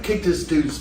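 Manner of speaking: ordinary talk, nothing marked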